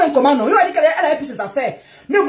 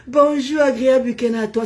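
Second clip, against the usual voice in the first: fausse douceur; 3 semitones lower than usual. Pretend. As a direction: put on an exaggerated gentle voice, lower and lingering. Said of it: "C'est ça que vous voulez que je parle". (douceur feinte, voix traînante)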